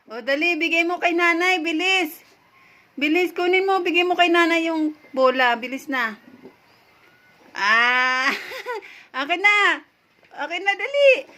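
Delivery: urging a pet to drop the ball, playful; coaxing the dog. Real, not acted.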